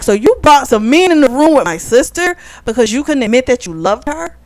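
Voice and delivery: mock furious. Brother confronting the man over his sister, mock furious. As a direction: act out his outrage in rising questions. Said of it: "so he's furious".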